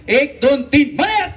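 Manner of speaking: rising count to a high shout-out; calling game commands. Real, not acted. Host calls out game commands.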